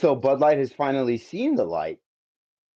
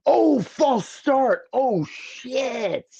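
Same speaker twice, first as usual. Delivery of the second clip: surprised groan; 13 semitones higher, much slower than usual. Real, not acted. Watching a kick get flagged.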